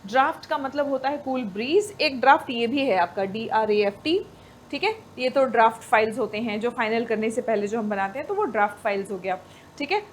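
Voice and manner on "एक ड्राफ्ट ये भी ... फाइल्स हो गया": ordinary talk, nothing marked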